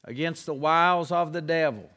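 scripture reading. Acted. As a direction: read the verses deliberately, word by word.

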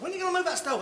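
high, whiny wife's nag, imitated; mock nagging wife. Wife's last nag, mocked.

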